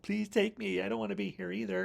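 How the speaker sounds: high, quick pleading plea; imitating a desperate plea; pleading Shelley. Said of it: "Shelley runs out to the car and is all like".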